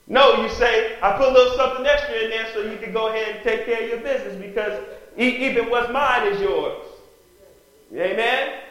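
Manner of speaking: loud, high impassioned preaching. Real, not acted. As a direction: preach it with fire to the congregation. Preacher at full force.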